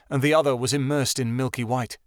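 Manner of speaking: ordinary delivery, nothing marked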